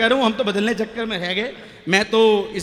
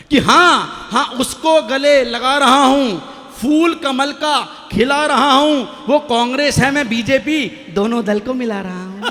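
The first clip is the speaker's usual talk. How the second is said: loud, slow poetic recitation; declaimed verse; 3 semitones higher, 3 dB louder, slower than usual. Shown as a demonstration. Poet reciting his four lines to his wife, loudly declaimed. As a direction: proclaim the lines like a stage poet. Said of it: "चार पंक्ति में कहता हूं".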